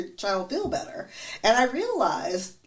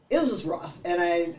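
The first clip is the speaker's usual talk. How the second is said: ordinary talk, nothing marked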